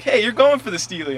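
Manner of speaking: high, loud imitated accusation; protesting kid. Kid catching him aiming at Steely, high mock protest. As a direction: pipe up like an indignant kid.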